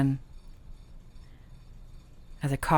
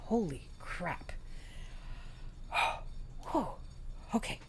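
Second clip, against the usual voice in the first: overwhelmed with emotion; 3 semitones higher, 13 dB quieter than usual. Real, not acted. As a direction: let the feeling catch the breath. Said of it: "I'm getting so emotional".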